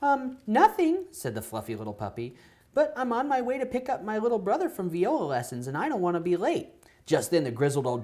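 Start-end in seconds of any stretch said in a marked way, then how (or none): 0.0-1.0 very high, slow and timid; high puppy voice. Acted. 2.7-6.7 high puppy voice. Acted.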